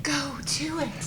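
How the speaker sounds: gentle nudge from his fiancée, softly whispered; soft urging whisper. A narrator's reading. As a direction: breathe it softly like a voice in his ear. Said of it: "Mavis's voice in his ears whispering".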